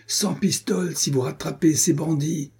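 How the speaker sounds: high-pitched narrated shout, little added volume. A narrator's reading. Pursuer offering his men a reward, shouting. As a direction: call out high to rally the rowers. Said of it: "cria-t-il".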